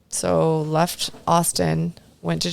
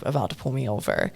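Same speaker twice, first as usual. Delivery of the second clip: usual speaking voice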